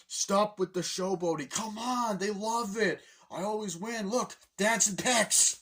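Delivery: high, animated bragging back-and-forth; hammed-up cartoon boasting; imitated showboating banter. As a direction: show off loudly to a nagging dad. Acts father and showboating son arguing.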